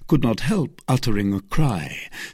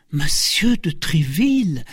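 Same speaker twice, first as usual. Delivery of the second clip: high-pitched, astonished exclamation; surprised young novice; novice's exclamation; 7 semitones higher than usual. A narrator's reading. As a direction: echo the name in surprise. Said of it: "exclaimed the novice".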